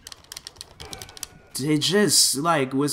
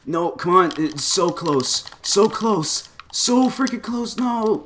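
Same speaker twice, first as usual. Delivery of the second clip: tense pleading; 9 semitones higher, slower than usual. Real, not acted. Almost winning a game fight.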